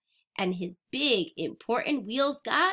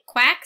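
loud quacking call; duck quack; 2 semitones higher, 9 dB louder than usual. Acted. Reading to kids, a loud duck quack. Animal sound in picture book.

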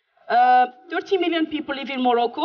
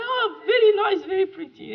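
gushing colleague; 9 semitones higher than usual. Retold or imitated. Colleague Debbie voiced.

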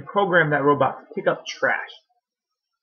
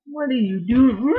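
high cooing question to the puppy; 9 semitones higher than usual. Real, not acted. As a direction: coo up high at the dog. Dog brings the toy.

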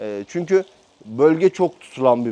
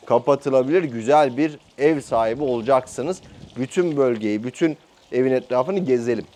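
normal talking voice

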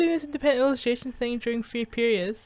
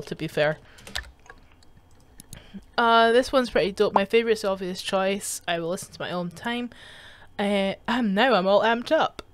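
ordinary delivery

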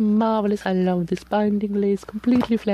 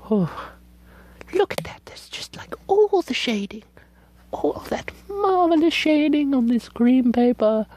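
whispered wonder at the shading; whispered admiration; awed whisper. Real, not acted. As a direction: hush down to a whisper, marveling.

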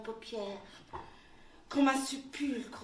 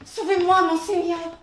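much louder, pleading cry; desperate plea; 5 semitones higher, 15 dB louder than usual. Acted. She cries out to her lord, begging to be saved. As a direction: beg him loudly to save you.

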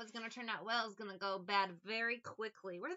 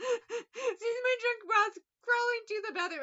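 much higher, louder laughing words; laughing hard; 12 semitones higher, 9 dB louder than usual. Real, not acted. Laughing at the memory of the drunk boss. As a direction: crack up while telling it.

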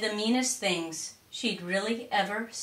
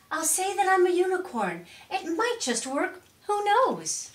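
high, squealing excitement; high-pitched giddy pony voice; squealing excited; 11 semitones higher, 3 dB louder than usual. A narrator's reading. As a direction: squeal it out with a brand-new idea. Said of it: "She squealed and jumped around".

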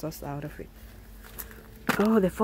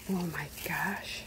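whisper; 10 dB quieter than usual. Real, not acted. Whispered in awe at apples.

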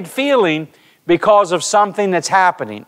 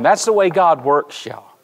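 ordinary talk

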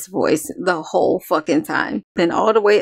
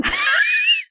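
a sudden high, whooping burst of laughter. Real, not acted. Reacting to a hard-hitting track, a whooping laugh.

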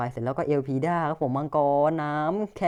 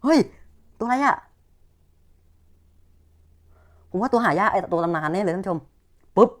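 excited; 5 semitones higher, 6 dB louder than usual. Real, not acted. Rare card pulled, excited.

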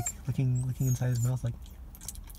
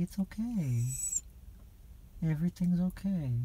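gentle, higher pet-soothing voice; soothing a scared dog; 4 semitones higher, 4 dB quieter than usual. Real, not acted. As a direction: comfort a crying puppy softly.

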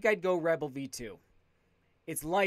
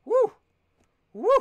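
excited whoop; 7 dB louder than usual. Real, not acted. Cheering alone.

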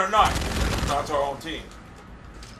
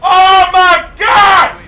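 high, loud, slow outburst; exasperated scream; 7 semitones higher, 9 dB louder, much slower than usual. Real, not acted. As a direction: stretch out a real cry of disbelief. Gamer killed again.

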